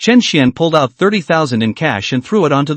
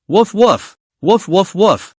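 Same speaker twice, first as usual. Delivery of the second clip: higher, harder woofs trying to sound ferocious; fierce wolf dog bark; 4 semitones higher, slower than usual. Acted. Girl trying to bark like a ferocious wolf dog, higher and harder. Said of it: "learn the strength of a wolf dog".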